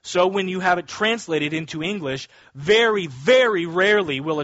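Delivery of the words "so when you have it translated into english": ordinary delivery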